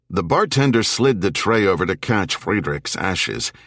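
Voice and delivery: plain reading voice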